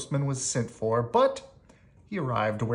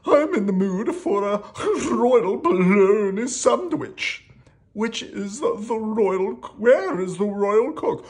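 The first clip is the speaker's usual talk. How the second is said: high, loud, slow royal voice; hammed-up high kingly voice; demanding storybook king; 10 semitones higher, 7 dB louder than usual. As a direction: relish each word like a hungry king.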